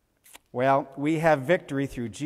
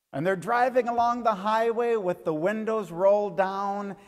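put-on lively storyteller voice, a little higher and bright for the kids; story for children; 5 semitones higher, 4 dB louder than usual. Tells children a bee story.

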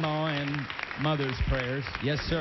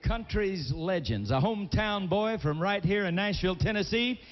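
announcer intro; 4 semitones higher than usual. Acted. Host brings on [name], big showman call. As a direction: build it up like introducing a legend.

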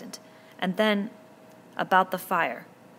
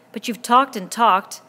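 indignant outburst; 5 semitones higher, 12 dB louder, slower than usual. Acted. Jack objecting angrily.

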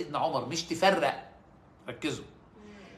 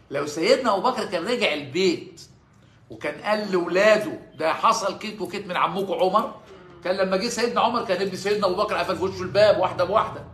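loud, pitched-up preaching; preaching at full force; 4 semitones higher, 9 dB louder than usual. Acted. Preacher to congregation, loud and driving. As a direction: drive the point home to the crowd. Preacher builds indignant hypothetical.

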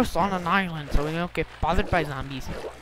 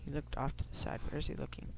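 whispering; 11 dB quieter than usual. Real, not acted. Peering quietly at a player.

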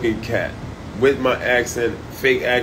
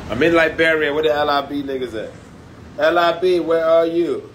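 raised, higher-pitched hail to the crowd; calling out; 5 semitones higher, 4 dB louder than usual. Real, not acted. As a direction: call out for your people, pitched up. Calling out to Liberian audience.

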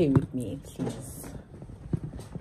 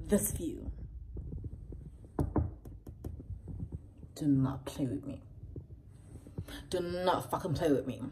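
softer whispered disbelief; awed whisper; 4 semitones lower, 9 dB quieter than usual. Real, not acted. Creator showing her view, a stunned whisper. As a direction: breathe it, can't believe it.

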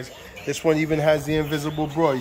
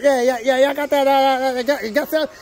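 imitating caller; 8 semitones higher than usual. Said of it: "He's like".

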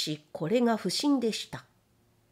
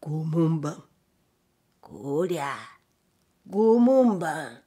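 drawn-out ghostly call, low then swelling; eerie put-on voice; 4 semitones lower, much slower than usual. A narrator's reading. Samurai taunting the retreating gatekeeper from hiding, eerie drawn-out call. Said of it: "また退屈男の言う声が聞こえました".

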